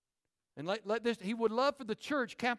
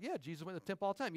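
fast casual aside; 2 semitones lower, 8 dB quieter, much faster than usual. Retold or imitated. Newcomer quoted casually.

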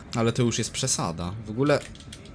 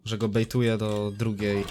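ordinary talk, nothing marked

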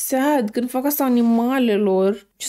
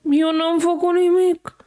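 higher, slow, whiny voice for the neighbour; denying neighbour; 5 semitones higher, slower than usual. Acted. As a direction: play the lying neighbour, pleading innocence. Neighbour denies the poisoning.

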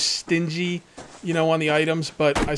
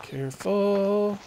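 drawn-out, higher "careful"; wary caution; 5 semitones higher, much slower than usual. Real, not acted. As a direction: stretch it out slowly, as if creeping past danger. Creeping past danger in the game.

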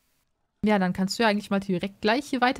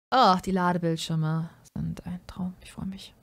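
whispering; 2 semitones lower than usual. Real, not acted. Whispering about loading screens.

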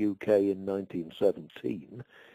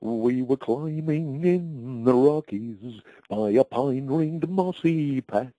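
slow, higher, sing-song verse recital; poem recitation; 4 semitones higher, 4 dB louder, slower than usual. Shown as a demonstration. Old man reciting his comic verse to the host, slow and lilting. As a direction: recite the rhyme slowly for the listener. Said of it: "I think if I could just remember one verse".

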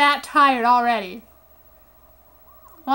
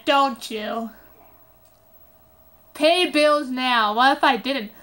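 ordinary talk

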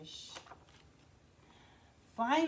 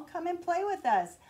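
higher and louder, calling to a friend; 6 semitones higher, 3 dB louder than usual. Acted. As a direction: call across the water to come play. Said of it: "they would call".

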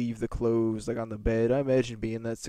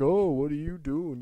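lightly put-on teacher voice, higher; mock a teacher's indignant telling-off; imitated scolding teacher; 4 semitones higher than usual. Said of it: "my mom ended up yelling at the teacher".

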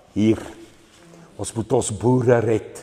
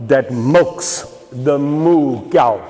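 usual speaking voice

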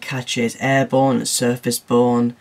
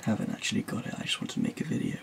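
hushed aside, lips close to the mic; a sneaky whispered admission; whispered confession; 6 semitones lower, 16 dB quieter, much faster than usual. Real, not acted. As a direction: let the secret slip out under your breath. Confessing the fake to camera.

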